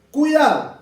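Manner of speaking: «Cuidado» alto y fuerte, cayendo; warning call. Real, not acted. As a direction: advierte con un «Cuidado» enérgico. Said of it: "warning the class". (profesor advierte a la clase: «Cuidado» fuerte y agudo)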